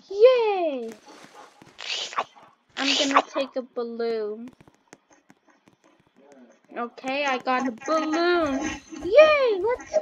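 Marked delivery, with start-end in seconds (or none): 0.1-0.9 high, loud cheer; loud high yay after getting candy; happy yay. Real, not acted. Got candy. 9.0-10.0 very high, loud cheer; loud high yay for a balloon; thrilled yay. Real, not acted. Got a balloon.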